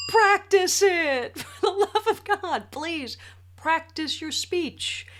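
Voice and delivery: high, loud, slow and laughing through it; laughing plea. Real, not acted. To the wedding speakers, pleading through laughter. Laughing after getting emotional.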